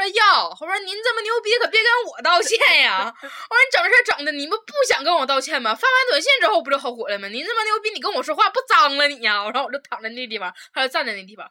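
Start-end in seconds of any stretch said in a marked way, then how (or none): 0.0-9.4 loud, high mocking sarcasm; mock her grandly, louder; sarcastic telling-off. (阴阳怪气地大声挖苦)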